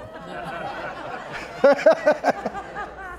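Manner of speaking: long breathy laugh; laughter. Real, not acted. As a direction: laugh at your own irony. Laughs at his own irony.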